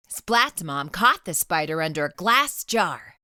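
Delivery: usual speaking voice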